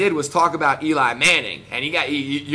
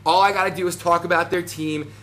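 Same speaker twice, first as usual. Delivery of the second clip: normal talking voice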